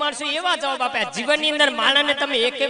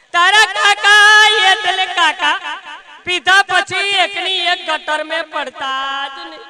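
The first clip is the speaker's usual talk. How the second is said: mock wailing lament; 2 semitones higher, 7 dB louder, slower than usual. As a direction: wail it out like a hammed-up mourner. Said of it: "રડતા રડતા કહેતા હતા".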